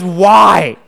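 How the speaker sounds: loud retold wail of disbelief; exasperated cry. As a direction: cry it out in mock despair. Acted despairing thought at the mess.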